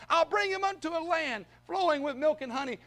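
high-pitched, eager promise; excitedly voicing God's promise; excited Lord. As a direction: proclaim it with rising excitement. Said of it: "Can't you hear the excitement in the Lord's voice".

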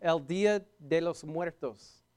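"El Dia de los Muertos" in a light Spanish accent, slowed; a playful touch of Spanish accent on "El Dia de los Muertos". Shown as a demonstration. Pastor joking with the congregation, light Spanish accent. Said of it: "bring in my Spanish accent".

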